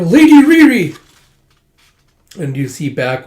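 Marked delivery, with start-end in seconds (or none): 0.0-1.0 high, loud scream of a name; anguished scream. Acted. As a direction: scream her name in despair. Cry as mistress is killed.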